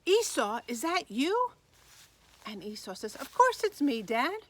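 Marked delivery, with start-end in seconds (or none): 0.0-1.5 high, louder, astonished question; startled storybook father. A narrator's reading. Isaac startled by real Esau. 3.1-4.4 higher, loud, indignant reply; acted son, baffled at the question; insistent son. A narrator's reading. Esau answers his doubting father.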